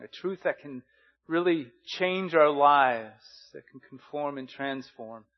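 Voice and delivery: earnest, measured pastor's prayer. Acted. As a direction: pray over the congregation.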